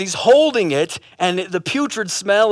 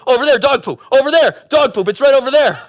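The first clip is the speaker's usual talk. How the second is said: high, loud pointing-out; frantic spotting; 5 semitones higher, 5 dB louder, faster than usual. Acted. Obsessed man pointing things out on family walk, frantic calling. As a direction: call out each sighting, mock-alarmed. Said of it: "Joe is spotting doggy poop left and right".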